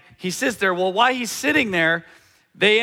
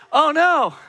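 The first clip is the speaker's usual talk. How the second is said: alarmed; 9 semitones higher, 5 dB louder than usual. Retold or imitated. Boy realizes danger.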